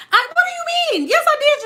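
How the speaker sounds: high-pitched, incredulous mock squeal; exasperated protest. Acted accuser protesting the denial.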